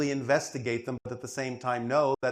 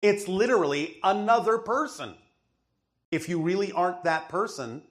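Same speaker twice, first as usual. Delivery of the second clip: usual speaking voice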